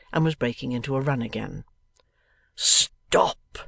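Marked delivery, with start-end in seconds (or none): 2.5-3.6 raised, breathy cry of "stop". A narrator's reading. Sampson stopping the runaway Kit, crying out. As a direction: call out to halt someone. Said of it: "cried Sampson".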